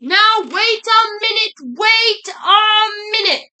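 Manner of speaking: slow, high, loud drawn-out protest; indignant cry. A narrator's reading. Jack the narrator interrupting the story, loud drawn-out cry. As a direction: stretch each word to halt everything. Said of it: "cried Jack the narrator".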